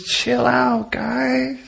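high, soft easygoing calm; laid-back steward. Pretend. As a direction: tell them to relax, unbothered. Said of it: "the steward just goes".

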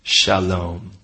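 low, calm shalom; low soothing Shalom; steward's greeting. Pretend. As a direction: greet them gently and low. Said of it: "the steward turns to him and he says".

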